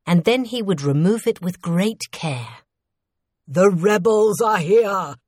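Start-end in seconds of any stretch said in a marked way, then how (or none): 3.5-5.1 loud, drawn-out warning cry; slow, projected shout of warning; shouted alarm. A narrator's reading. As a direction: call the alarm through the castle. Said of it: "someone shouted".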